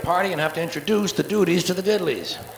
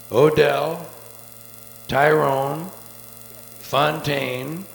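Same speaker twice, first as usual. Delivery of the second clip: ordinary talk, nothing marked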